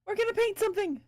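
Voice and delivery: bright, high announcer voice; playful high voice. Pretend. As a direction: announce it like a big reveal.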